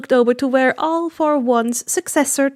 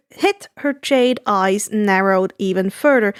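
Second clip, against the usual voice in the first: usual speaking voice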